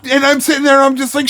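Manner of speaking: ordinary talk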